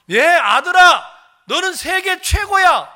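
high, loud cheer for a son; emphatic praise. Pretend. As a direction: proclaim it proudly to your child. Models a mother praising her son.

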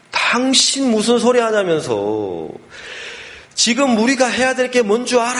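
high, drawn-out wifely yell; imitated scolding shout. Startled wife scolding her wavering husband, acted shouting. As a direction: act out an outraged telling-off. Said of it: "소리치는 거야".

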